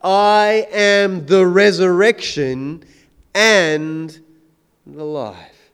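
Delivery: drawn-out words, each one leaned on; slow, weighty proclamation. Shown as a demonstration. Preacher quoting Jesus to the congregation, slow and weighty. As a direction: proclaim it slowly, letting it land. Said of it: "And Jesus says".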